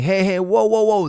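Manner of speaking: high loud shouted whoas; a coach yelling from the corner to stop the restart. Retold or imitated. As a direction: call out to stop the ref. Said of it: "and I'm yelling".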